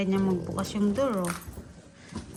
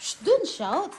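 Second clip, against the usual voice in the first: ordinary delivery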